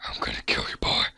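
sinister whispered villain voice; Nathan. Acted. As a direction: whisper a mock threat. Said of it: "I always thought his voice was like".

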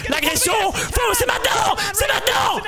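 high, excited shouting; urgent hype. Real, not acted. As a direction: urge them to go right now. Urging the team to attack now.

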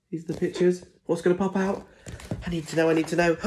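high, eager can't-wait voice; eager suspense. Real, not acted. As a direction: build the suspense. Building suspense for children.

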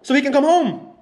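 high, furious demand to get out; angry friend ordering her out of the car; angry yelling. Real, not acted.

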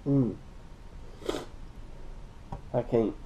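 trailing off in disgust; giving up on a foul taste; after bitter medicine. Real, not acted. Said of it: "can't get the taste out".

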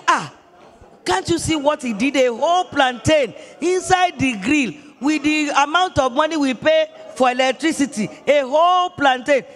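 mock-shouting about the wasted plantain; retold shout. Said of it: "Why did you shout like that?".

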